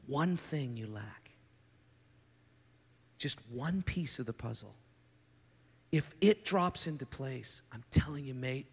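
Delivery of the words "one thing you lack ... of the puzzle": whisper. Shown as a demonstration. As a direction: breathe it like a secret. Repeating Jesus' verdict softly.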